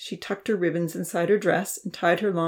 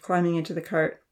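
ordinary delivery

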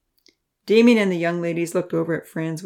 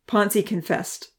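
usual speaking voice

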